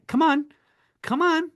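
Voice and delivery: rally the room, pitch way up; urging come on. Real, not acted. Coach urges fundraisers to get passionate, excited high pleading.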